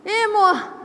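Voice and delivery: a fake-sweet brush-off to the husband; mock dismissive reply. Resposta irritada imitada. (imitando a resposta seca ao marido, bem mais aguda)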